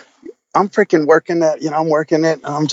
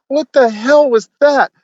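retold shock; 9 semitones higher, slower than usual. Retold shocked reaction to the episode.

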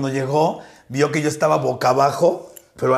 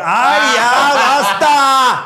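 loud, high playful outcry; mock protest shout; 7 semitones higher, 6 dB louder, much slower than usual. Host putting a stop to the joking, loud mock-exasperated cry.

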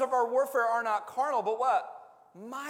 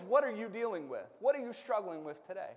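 usual speaking voice

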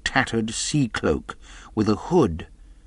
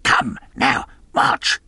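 louder cold order from the blind man; cruel cold command; 3 semitones higher, 4 dB louder, slower than usual. A narrator's reading. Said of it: "a voice so cruel and cold and ugly".

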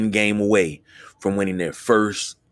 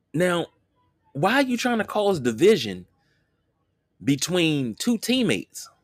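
high-pitched exasperated rant; 10 semitones higher than usual. Real, not acted. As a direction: rant in disbelief, voice way up. Heated rant over dumb question.

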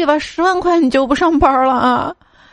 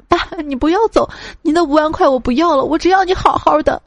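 higher, crying out in mock grief; tearful shout; 6 semitones higher than usual. A narrator's reading. Child cries out at the deathbed, hammed-up tearful shout. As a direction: call out to a dying father, mock sobbing. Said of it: "痛哭流涕，大喊".

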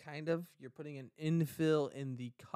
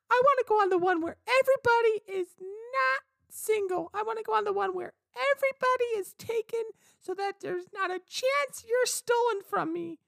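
wife's voice; 6 dB louder than usual. Acted. Wife voiced in a cruise skit.

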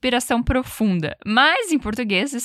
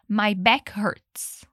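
very slow, word by word; slow model; 2 semitones lower, much slower than usual. Shown as a demonstration. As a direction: draw each word out for learners. (bem devagar, palavra por palavra)

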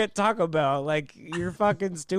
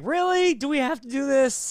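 much higher, fed-up sigh of a question; weary exasperation pitched up; exasperated attitude; 9 semitones higher than usual. Retold or imitated. Driver fed up with the customer's fit, exasperated. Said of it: "that kind of attitude".

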